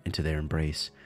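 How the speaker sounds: ordinary talk, nothing marked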